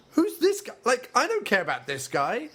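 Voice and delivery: high-pitched, incredulous mock reaction; baffled, put-out protest; exasperated disbelief. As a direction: start very high in disbelief, then ease down slightly. Acted reaction to new character.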